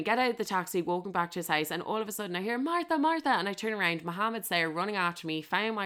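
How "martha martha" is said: calling out. Retold or imitated. Driver calling after her.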